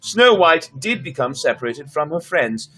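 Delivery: measured storybook reading voice; story narration. A narrator's reading. Storyteller reading a Russian fairy tale to viewers, lightly voiced. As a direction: read the tale aloud to listeners.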